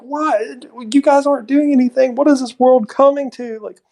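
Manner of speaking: high-pitched imitation of an indignant yell; mock yelling. Imitating a man yelling at the mechanics, mock yell. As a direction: rant high in mock outrage. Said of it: "he's, like, yelling at the mechanics".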